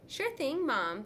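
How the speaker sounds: put-on high, sugary sweet girl voice; butter up mom, sweetly; little sister's sugary reply to mom; sweetly fake. A narrator's reading. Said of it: "smiling sweetly".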